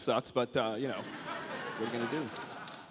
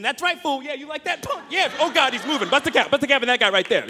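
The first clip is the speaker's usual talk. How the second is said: mock gangster hype; 8 semitones higher, 4 dB louder than usual. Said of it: "I can get some action".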